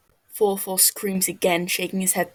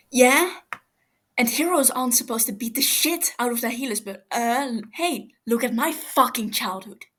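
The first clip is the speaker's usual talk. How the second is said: louder, quicker sarcastic comeback; bitter retort; 4 semitones higher, 3 dB louder than usual. Acted. Tommy bitterly retorts to Fofo, louder and fast. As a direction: throw it back at him, stung.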